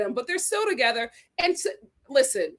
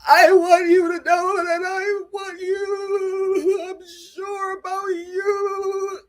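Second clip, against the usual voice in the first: higher, slow, sobbing mock cry; crying gnome voice; 7 semitones higher, 5 dB louder, much slower than usual. Mimicking Thomas crying to Becca, slow high sob. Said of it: "you are crying like a gnome".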